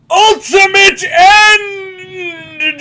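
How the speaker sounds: high, slow, loud hammed-up battle cry; dramatic shout. Host acting the heroine's screamed words, huge and slow. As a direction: stretch it out like an epic finishing move.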